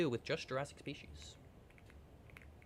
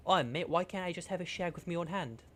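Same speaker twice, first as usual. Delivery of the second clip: put-on British accent; 4 semitones higher than usual. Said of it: "The U K government".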